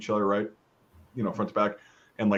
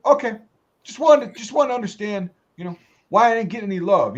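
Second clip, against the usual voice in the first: high, petulant, put-on sergeant voice; whiny sergeant; 7 semitones higher, 3 dB louder than usual. Master sergeant complaining about being ignored, high whiny voice. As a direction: whine like a hurt superior. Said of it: "he's like".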